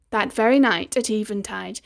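ordinary delivery

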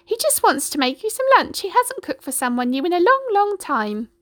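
high, chirpy hen; chicken voice. A narrator's reading. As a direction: chatter like a friendly hen.

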